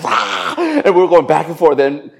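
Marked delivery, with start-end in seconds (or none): 0.0-0.8 high mock scream; hammed-up high shriek; imitated shriek. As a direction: imitate her shrieking back. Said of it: "She goes".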